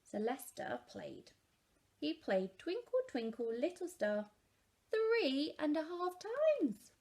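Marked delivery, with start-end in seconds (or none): none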